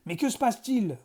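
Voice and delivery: braille-le en arrivant au pas de charge; braillement. A narrator's reading. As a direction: question braillée, plus haute. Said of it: "brailla ce dernier". (directeur qui braille)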